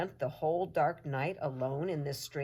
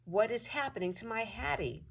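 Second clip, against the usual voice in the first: plain reading voice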